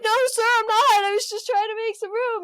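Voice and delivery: high, quick mock apology; mock pleading. Yoga man defending himself to an angry guy, mock pleading. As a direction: plead your innocence, hammed-up. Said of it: "he's like".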